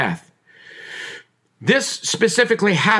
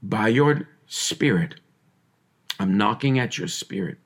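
voice of Jesus; 10 semitones lower, 5 dB quieter than usual. Acted. Preacher voicing Jesus knocking, low and hushed. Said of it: "Jesus is saying".

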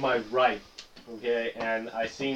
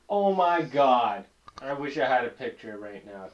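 ordinary talk, nothing marked

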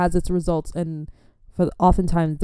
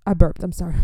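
ordinary talk, nothing marked

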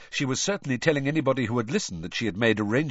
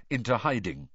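plain reading voice

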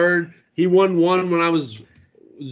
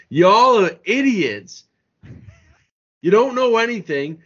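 ordinary talk

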